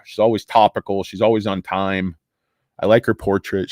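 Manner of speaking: ordinary talk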